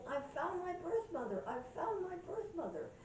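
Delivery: high, mock-elated announcement; excited retold cry. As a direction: imitate someone bursting with news. Retold woman running down hallway saying it.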